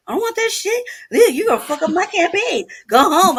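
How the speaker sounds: hammed-up brother shooing him off with a laugh; mock bossy brother. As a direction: play the fussy brother sending you home. Brother kicks him out, retold.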